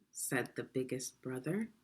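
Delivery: plain reading voice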